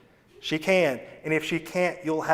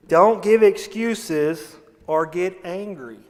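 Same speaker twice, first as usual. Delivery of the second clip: usual speaking voice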